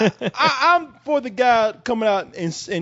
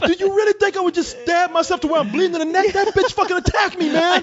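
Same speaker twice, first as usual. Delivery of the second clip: high, pitched-up protest; indignant fake victim; 9 semitones higher than usual. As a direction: act outraged and wronged. Pretending he was attacked.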